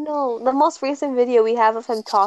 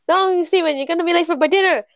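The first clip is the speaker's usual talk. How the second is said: Niall impression; 6 semitones higher, faster than usual. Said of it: "I can't understand Niall".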